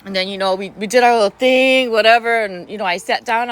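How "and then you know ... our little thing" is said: usual speaking voice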